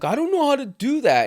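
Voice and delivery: high, whiny helpless act; mock helpless voice. Mocking weaponized incompetence.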